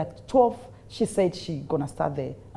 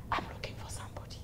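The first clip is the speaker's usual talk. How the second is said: whispered, quick and hushed; very soft whispered imitation; imitating woman preacher; 15 dB quieter, much faster than usual. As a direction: murmur it like a preacher searching the room. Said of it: "You know the way she speaks".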